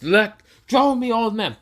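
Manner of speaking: high, loud enraged yell; furious shout. Acted. Boruto yelling at his father before punching him, furious.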